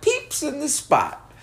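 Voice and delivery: high, slow squeaky mockery; mocking imitation. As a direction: stretch it out high to ridicule it. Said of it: "He was like".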